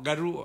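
"กะรุ" with voiced g, ga-ru; Indian pronunciation. Shown as a demonstration. Lecturer showing the true Pali sound, "กะรุ" with g. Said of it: "ถ้าจะออกเสียงจริงคือแขกออกมาออก".

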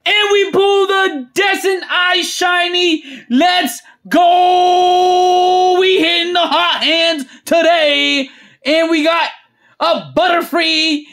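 high, shouted pull announcement; ecstatic announcing. Real, not acted. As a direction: belt it out and stretch the celebration. Announcing another shiny pull.

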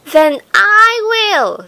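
firm little hen deciding alone; slow high determined hen. Acted. Hen resolves to do it.

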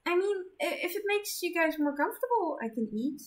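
put-on timid gentle girl; shy girl voice. Self-conscious Zenith.

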